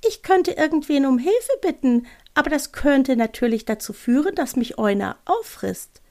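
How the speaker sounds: high, timid owl easing down at the end; little owl voice. Acted. Owl fearing it may get eaten, high voice sinking slightly. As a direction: think aloud as a small, worried owl. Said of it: "Die leise Stimme sprach weiter".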